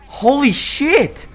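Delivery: can't believe what he's seeing; stunned excitement. Real, not acted. Gamer stunned by trailer footage, loud high amazement.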